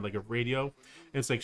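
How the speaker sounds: usual speaking voice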